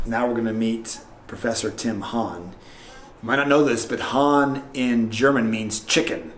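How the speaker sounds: low, softening aside to camera; confiding intro. Pretend. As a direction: share gossip discreetly, deadpan. Confides to camera before meeting guest.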